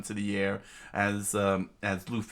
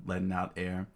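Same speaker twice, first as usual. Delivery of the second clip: ordinary delivery, nothing marked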